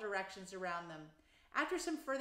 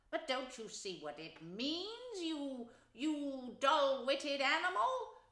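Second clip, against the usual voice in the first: slow, exasperated cry; impatiently; 6 semitones higher, slower than usual. A narrator's reading. Rat exasperated with mole, impatient. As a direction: scold the slow friend. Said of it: "cried the rat impatiently".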